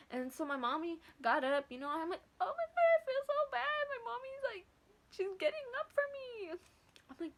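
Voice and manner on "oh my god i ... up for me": very high, tearful wail; mock crying. Daughter feeling bad that mommy got up, mock crying. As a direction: squeal it like guilty sobbing, hammed up. Guilty crying as mom gets up.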